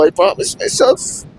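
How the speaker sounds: tearful, breaking whisper; crying while quoting the promise; choked up. Real, not acted. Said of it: "Pag naisip ko kasi, naiyak ako".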